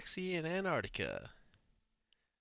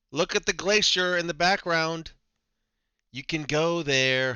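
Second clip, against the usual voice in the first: mock excitement; 3 semitones higher, 7 dB louder than usual. Mock excited about Antarctica.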